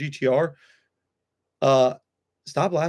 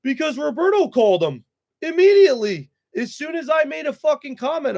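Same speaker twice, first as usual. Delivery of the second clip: heated outburst; 12 semitones higher than usual. Real, not acted. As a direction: burst out in real anger. Heated rant about Roberto calling.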